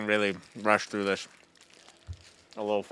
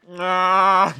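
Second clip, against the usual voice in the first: loud, high "nah"; playful drawn-out cry; 7 semitones higher, 9 dB louder than usual. Pretend. Fooling around mid-game, loud high wail. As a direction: let out a long, raised mock cry. Playful noise while playing.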